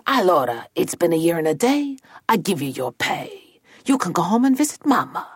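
a hint of an Italian ogre paymaster; touch of Italian-accented ogre; lightly voiced ogre boss. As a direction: grant the pay like a generous old boss.

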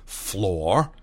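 ordinary delivery, nothing marked

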